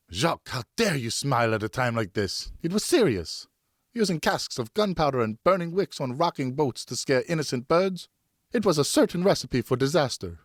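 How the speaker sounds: a hurt mother rebuking; scolding wife. Acted.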